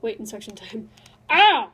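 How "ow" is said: sharp loud yelp of pain. Real, not acted. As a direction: yelp as cold hands sting. Hands stinging from ice water.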